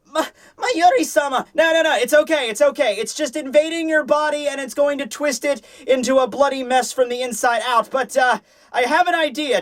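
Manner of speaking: high, put-on comic voices trading lines. Nemu calling for Mayuri who casually suggests surgery, high comic voice. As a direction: play both parts in a high squeaky register.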